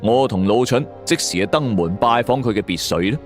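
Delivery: ordinary delivery, nothing marked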